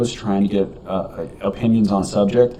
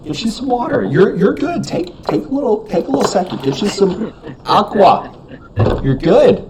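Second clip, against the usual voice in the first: mock caring; 12 semitones higher than usual. Mock-caring for choking friend.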